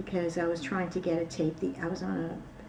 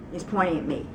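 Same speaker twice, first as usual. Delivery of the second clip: ordinary talk, nothing marked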